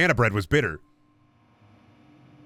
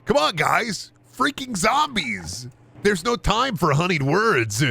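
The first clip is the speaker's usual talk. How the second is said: much higher, slow whining plea; zombie-obsessed roleplayer; 8 semitones higher, slower than usual. Pretend. Streamer as RP pest, high and slow. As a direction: drag out the nag like a pest. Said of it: "running around and saying".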